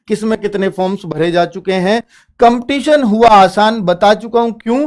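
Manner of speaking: ordinary delivery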